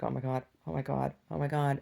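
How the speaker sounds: scared murmur under the breath; hushed panic. Retold or imitated. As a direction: mutter it like a frightened shopper. Shoppers whispering in fear.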